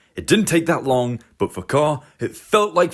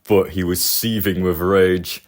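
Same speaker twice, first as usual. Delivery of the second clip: ordinary delivery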